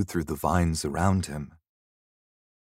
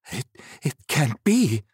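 higher, stammered disbelief; alarmed disbelief; 6 semitones higher than usual. Acted. Frightened young man seeing the mist rise, alarmed disbelief.

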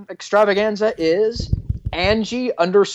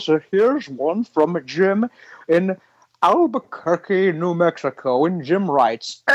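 mock doctor host; slower than usual. Comedy podcast doctor persona voice.

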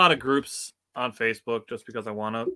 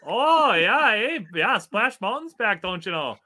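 put-on high, loud sing-song Canadian accent; mock Canadian accent; 9 semitones higher, 5 dB louder, slower than usual. Podcaster playing up outsiders' view, mock Canadian accent. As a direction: stretch it out like a cartoon Canadian. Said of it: "start talking like that".

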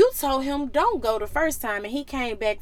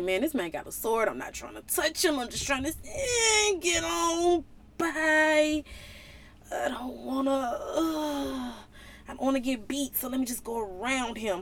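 scared donkey; 5 dB quieter than usual. Acted. Donkey explains, scared of the angel.

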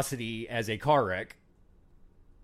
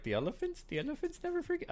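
high mock voice; 13 semitones higher, 9 dB quieter, faster than usual. Mocking Dixie's line, unsure.

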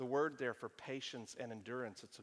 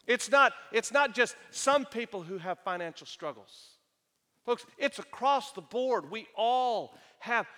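loud, higher preaching at full force; 5 semitones higher, 9 dB louder than usual. Acted. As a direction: drive the point home to the congregation. Preaching that everyone has trials.

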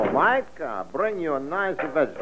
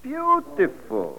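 awed; 13 semitones higher, much slower than usual. Acted. Smitten at first sight.

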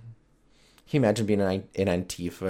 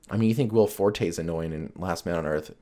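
ordinary talk, nothing marked

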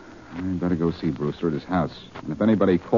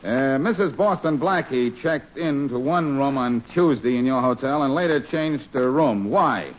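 higher, put-on gruff police voice on the phone; brusque impostor cop, pitched up; posing as Faraday; 5 semitones higher than usual. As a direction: pass yourself off as the inspector. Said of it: "This is Inspector Faraday of the police".

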